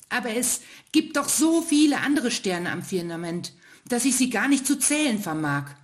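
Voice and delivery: hohe Kinderstimme des kleinen Sterns; kleiner Stern. Acted. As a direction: kindlich widersprechend, etwas lauter. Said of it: "antwortete der kleine Stern". (kleiner Stern widerspricht dem ältesten Stern)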